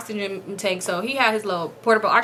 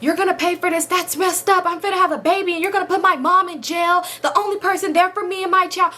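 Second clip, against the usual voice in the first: a touch of mock daughter; 7 semitones higher than usual. Daughter threatening, retold raised voice.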